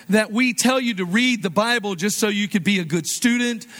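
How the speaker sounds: usual speaking voice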